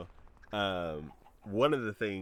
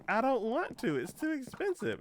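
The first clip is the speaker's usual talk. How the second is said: whiny refusal; 6 dB louder than usual. Retold or imitated. Refusing the promo emails.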